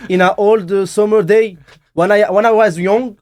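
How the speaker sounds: high, loud mock Indian English; halting story in an exaggerated Indian accent. Shown as a demonstration. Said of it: "avec un accent indien". (accent indien caricatural, fort et aigu)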